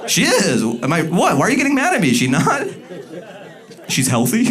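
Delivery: defensive. Real, not acted. Arguing with the audience.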